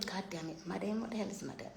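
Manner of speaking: very soft puzzled mutter; muttering under breath. Real, not acted. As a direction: mumble it to yourself. Muttering at a username.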